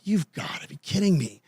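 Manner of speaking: barely voiced private dismay; quiet thought to himself, not said aloud; inner thought. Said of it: "I'm thinking to myself".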